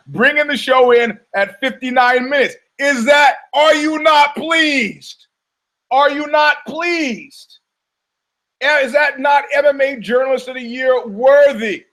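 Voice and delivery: high, loud theatrical shout with drawn-out endings; hammed-up triumphant bellow; mock-triumphant boast. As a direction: demand applause like a showman.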